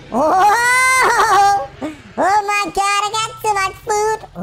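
loud, high, sing-song glee; triumphant sing-song over the stolen food; gleeful shout. Real, not acted. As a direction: crow with delight. Greedy delight, singing.

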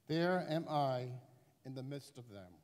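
ordinary talk

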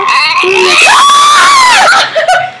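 high, loud burst of laughter; loud laughing shriek. Real, not acted. Girl cracking up at the camera mirror trick, shrieking.

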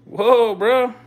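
high, loud startled cry; startled whoa. Real, not acted. Startled by another strike, high loud whoa. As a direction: flinch at the strike.

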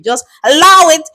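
imitated British accent. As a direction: lightly put on a British accent. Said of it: "it's a British accent to say".

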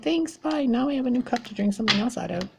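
high, quick, breezy mocking voice; mock careless ex. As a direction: toss off a careless goodbye, hammed-up.

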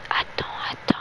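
breathy whisper. Real, not acted. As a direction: whisper it under your breath. Unvoiced whisper before ASMR.

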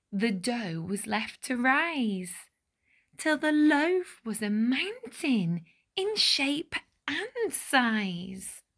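ordinary delivery

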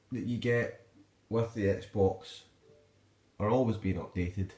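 ordinary delivery

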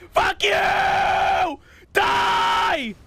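angry battle shout. Real, not acted. Attacking enemies in fight.

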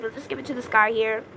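ordinary talk

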